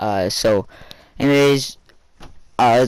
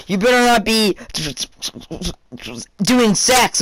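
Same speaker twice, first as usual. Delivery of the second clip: shrill it like an angry mother; scolding mom; 9 semitones higher, slower than usual. Retold or imitated. Mom warns her son about sex, high scold.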